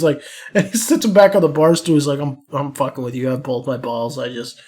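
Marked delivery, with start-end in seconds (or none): 2.2-4.6 soft, low, casual aside; dropping the act. Retold or imitated. Veteran calming the kid on the bar stool, low and offhand. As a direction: let the mock rage fall away, low and quiet.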